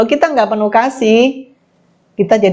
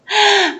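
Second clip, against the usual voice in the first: a quick amused laugh, pitched higher; short high laugh; 11 semitones higher than usual. Real, not acted. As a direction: laugh off the gossip. Amused at gossip about her.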